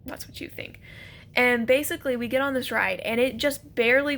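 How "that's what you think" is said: whispered retort. Retold or imitated. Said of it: "And I'm like".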